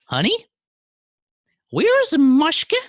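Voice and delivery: very high, drawn-out, loud wife; loud falsetto wife calling her husband; wife's voice. Acted. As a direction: put on a piercingly high wife, stretching the question. Wife asks after Mushka in story.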